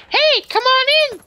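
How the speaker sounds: put-on very high, loud girly call; put-on girl voice. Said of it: "is your voice".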